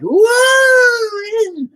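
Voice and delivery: very high, loud shout; mock party-animal yelling; imitated drunken whooping. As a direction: drag it out in a high-pitched holler. Acts out his drunken self.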